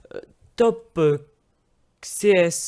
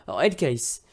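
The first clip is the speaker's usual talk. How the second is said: ordinary talk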